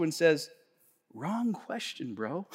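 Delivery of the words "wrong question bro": quiet retort. Acted.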